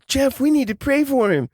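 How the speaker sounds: very high, loud mock-panicked mom; alarmed plea. Mom tells dad to pray for son, alarmed plea. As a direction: imitate a mother urging, voice shooting up.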